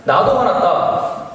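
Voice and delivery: high, loud, drawn-out mock anger; hammed-up comeback, stretched out slowly; mock angry retort. Intruder retorting to the student, comically angry. Acted quarrel, intruder answers back.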